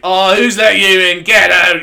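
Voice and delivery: loud, high detective outburst; mock angry shout. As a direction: yell as an outraged detective throwing someone out. Acted cynical detective ejecting psychic.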